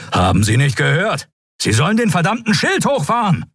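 high, rising angry shout; furious order. Acted. Admiral furiously orders Rishmawar to raise the shield, shouting. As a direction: tear into the officer.

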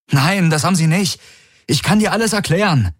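lightly voiced desperate plea; pleading envoy. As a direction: beg her to call the ships back. Garlin protests.

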